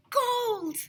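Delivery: high excited shout of gold. A narrator's reading. Kid on the playground shouting at the find. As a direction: yell it out in triumph. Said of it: "shouted Ryan".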